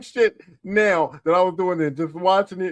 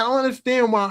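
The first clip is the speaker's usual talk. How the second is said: usual speaking voice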